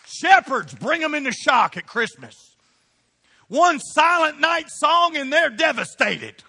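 loud, high, shouted sermon climax; preacher firing up the church; full-force preaching. Acted. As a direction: rouse the congregation, pounding each line.

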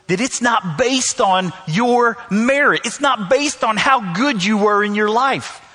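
full-force preaching. Acted. Preaching builds up.

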